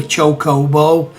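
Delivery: Chocobo said slowly, each O long and clear; proper pronunciation. Shown as a demonstration. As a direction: spell out Chocobo carefully, cho-co-bo. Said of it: "saying it properly".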